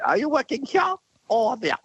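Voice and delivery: very high, plummy royal small talk; the Queen. Retold or imitated. Comedian mimicking the Queen greeting performers in the lineup, high mock-regal voice. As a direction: put on a high-pitched Queen's voice, far from your own. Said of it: "and she said".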